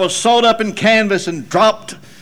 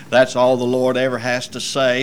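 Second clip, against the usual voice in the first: normal talking voice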